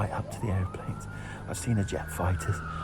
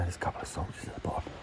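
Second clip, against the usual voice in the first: whispering; 4 semitones lower, 5 dB quieter, faster than usual. Real, not acted. Soldiers nearby, hiding.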